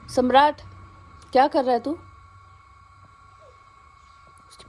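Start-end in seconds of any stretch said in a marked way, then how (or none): 0.1-2.0 loud scolding call to the pet; calling the dog. Real, not acted. Owner calling her dog Samrat away, loud call. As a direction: call him off, louder.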